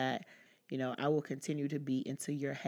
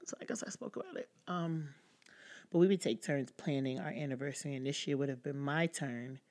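ordinary talk, nothing marked

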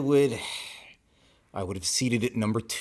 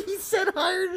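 very high, squealing laugh-talk; words squeezed through helpless laughter. Real, not acted. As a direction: barely get it out for laughing. Cracking up over misheard joke.

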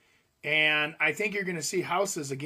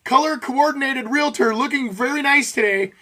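hammed-up announcer voice, raised and loud; showman greeting; 6 semitones higher, 7 dB louder than usual. Realtor clowning for his clients, hammed-up announcer. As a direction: play the goofy realtor entertaining clients. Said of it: "I'll come in and go".

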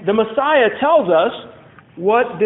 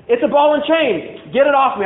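higher-pitched protest, rebelling at a bond; indignant complainer; 6 semitones higher, 3 dB louder than usual. Acted. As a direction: act out someone fed up and wanting out. Acted complaint against marriage.

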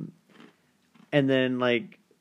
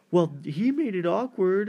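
high-pitched retort as the caller; mock defensive; 9 semitones higher, 4 dB louder than usual. Girl caller blaming her date as call ends, mock defensive. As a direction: throw the blame back, pitched well up. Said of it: "And she's like".

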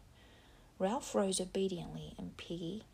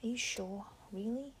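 a scared hushed question; 4 semitones higher than usual. Acted. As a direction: whisper it nervously.

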